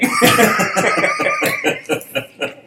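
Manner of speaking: high, long burst of laughter; real laugh. Real, not acted. Co-host teased about meeting a player, high real laughing fit. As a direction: crack up at the quip.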